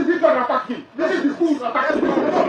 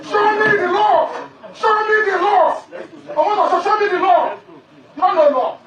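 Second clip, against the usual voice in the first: angry shout; 4 semitones higher than usual. Real, not acted. Defendant yelling at judge while being removed, angry shout. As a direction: shout the challenge with scornful laughs.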